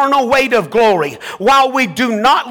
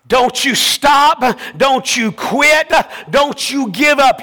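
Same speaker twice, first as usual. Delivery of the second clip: fervent sermon delivery; full-voiced pulpit preaching; 4 semitones higher than usual. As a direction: preach it to the congregation.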